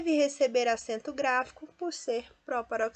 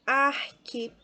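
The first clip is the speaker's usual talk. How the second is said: "arquipélago" syllable by syllable, PÉ punched louder; stress on pé; 3 semitones higher, 4 dB louder than usual. Shown as a demonstration. Teacher shows students the strong syllable, "arquipélago" stressed on PÉ. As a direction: break up "arquipélago", leaning hard on "pé". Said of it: "Veja que pé é a sílaba mais forte".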